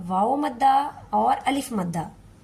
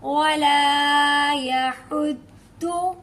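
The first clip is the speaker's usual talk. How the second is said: very slow chanted वला यहुद्दु; Quranic recitation; 3 semitones higher, 4 dB louder, much slower than usual. Shown as a demonstration. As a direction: recite slowly with tajweed. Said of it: "हमारी अगली आयत देखें".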